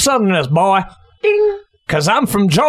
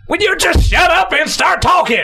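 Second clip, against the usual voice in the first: exasperated yell; 9 semitones higher than usual. Acted. Host snaps at the debater to start talking, yelling. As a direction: yell at him to finally talk.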